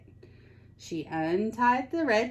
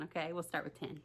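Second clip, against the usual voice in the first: plain reading voice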